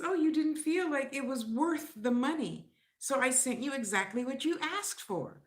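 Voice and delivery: high, sing-song sarcastic reply; sarcastic seller. Retold or imitated. Seller's snarky reply in meme.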